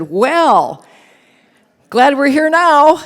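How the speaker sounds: loud, high wry quip from Bruce; Bruce's quip. Retold or imitated. Husband joking loudly as his wife cries. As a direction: throw it out loud and high like a joke. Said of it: "And Bruce said".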